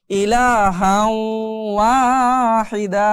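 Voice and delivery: ইলাহাও ওয়াহিদা very slow and drawn; slow Quranic recitation. Shown as a demonstration. Teacher recites the verse slowly for the class. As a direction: chant it out long. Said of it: "তাহলে".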